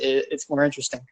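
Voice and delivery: ordinary talk, nothing marked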